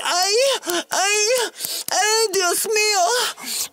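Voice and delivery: high, slow hammed-up Spanish wail; mock Spanish accent. Woman switching to a Spanish accent mid-act, high hammed-up wail. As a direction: cry out like a telenovela lady. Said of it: "doing all kinds of freaking different accents".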